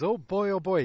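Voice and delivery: mock eager anticipation; excited. Dad receiving his gift, excited. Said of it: "he's all excited".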